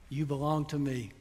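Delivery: put-on like a father soothing a child, softly; gentle hushed promise; tender whisper-soft. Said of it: "And the last word".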